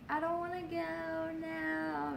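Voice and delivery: hammed-up reluctant coward; whiny wuss. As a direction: whimper it like a little wuss. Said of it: "just being a little wuss".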